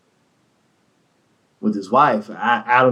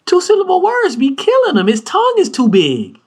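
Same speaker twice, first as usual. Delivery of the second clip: high squeaky teasing lilt; mock high teasing. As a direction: poke fun way up high. Teasing his literacy.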